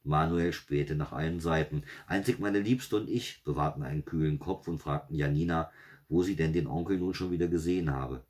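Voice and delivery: usual speaking voice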